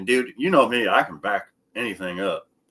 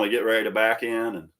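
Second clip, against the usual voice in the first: ordinary talk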